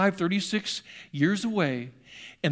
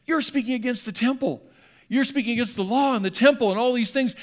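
higher, louder mock crowd accusation, building; hammed-up outrage of the crowd, rising in force; angry crowd accusing; 5 semitones higher, 3 dB louder than usual. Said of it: "the anger begins to rise".

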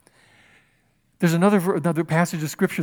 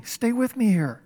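ordinary delivery

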